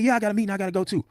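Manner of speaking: high-pitched, quick retort imitating her; mock high voice, rushed answer; mimicked woman's reply. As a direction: put on her high voice, hurried.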